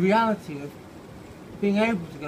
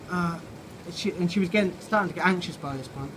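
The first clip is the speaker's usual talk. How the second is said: usual speaking voice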